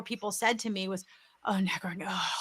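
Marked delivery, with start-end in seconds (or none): 1.5-2.3 hushed, voiceless mimicry of dread; imitated cringing groan. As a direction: shrink away with a breath-heavy "ugh". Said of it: "they would shrink and make that noise".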